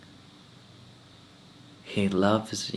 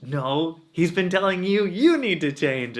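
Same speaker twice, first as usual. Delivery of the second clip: high, louder insistence; wife insisting the advice was for him, high and indignant; 8 semitones higher, 5 dB louder than usual. Retold or imitated. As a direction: fire it back at him. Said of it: "And she's like".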